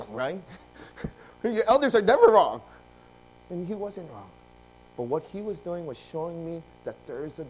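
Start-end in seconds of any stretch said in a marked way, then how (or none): 1.4-2.6 loud, high comic assertion; joking proclamation. Acted. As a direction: declare it with mock insistence. Joking aside about elders.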